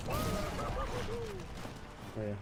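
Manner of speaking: ordinary talk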